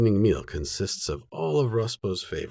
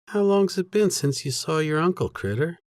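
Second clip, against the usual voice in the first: a higher, brighter-pitched woman's question; lightly voiced Jenna; 9 semitones higher, 3 dB louder than usual. A narrator's reading. Sister asking her nephew about his uncle, lightly voiced higher. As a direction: lift the voice for the sister's fond question.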